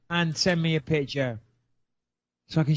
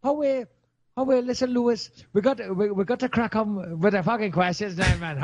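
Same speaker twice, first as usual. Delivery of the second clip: higher mock Geordie that drifts Irish; badly faked Newcastle accent, turning Irish; Geordie accent slipping into Irish; 5 semitones higher than usual. Host urging co-host on to questions, wobbly Geordie-Irish accent. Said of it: "going into Irish".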